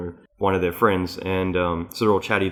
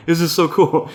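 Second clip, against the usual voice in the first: high, loud retold delight; happy excitement; 9 semitones higher, 6 dB louder than usual. Retelling his joy at the bar joining in, happy. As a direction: gush like a thrilled kid. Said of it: "I was, like, so happy".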